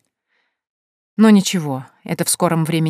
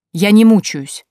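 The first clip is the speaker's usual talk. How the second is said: higher, louder protest; defensive, raised-voice retort; sharp denial; 4 semitones higher, 7 dB louder, slower than usual. A narrator's reading. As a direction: push back hard at your mother. Дочь возражает матери в ссоре.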